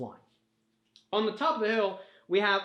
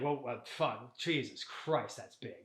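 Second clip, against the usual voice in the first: awed mutter; 6 semitones lower, 10 dB quieter, slower than usual. Acted. Awestruck at the giant robot.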